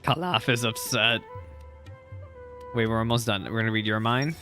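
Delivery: ordinary delivery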